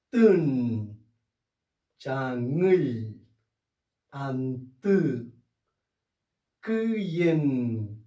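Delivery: each Mapudungun word spaced out and said clearly for students; careful word drill. Shown as a demonstration. Teacher modelling words for students, careful pronunciation. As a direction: sound out each word for the class. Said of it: "repeats drill words".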